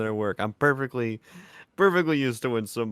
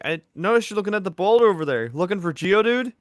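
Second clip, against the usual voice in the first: higher, louder cheerful call; loud eager greeting; 9 semitones higher, 6 dB louder than usual. Acted. Bert calling to the stranger, loud and eager.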